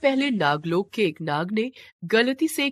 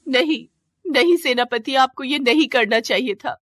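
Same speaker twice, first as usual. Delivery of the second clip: higher, louder cry of grief; anguished cry; 6 semitones higher, 5 dB louder than usual. Acted. Shivalika cries out as the commander dies for her, anguished. Commander takes fatal blow.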